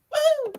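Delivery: high "woo" cheer; Tiffany-like whoop. Real, not acted. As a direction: let out a high, drawn-out whoop. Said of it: "That sounded like Tiffany".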